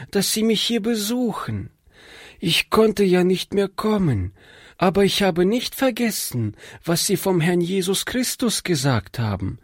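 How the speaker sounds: put-on high, bright little girl voice full of joy; glowing with happiness at the visit; beaming with joy. A narrator's reading. Sick little girl greets the preacher, beaming with joy. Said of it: "Vor Freude strahlend sagte sie".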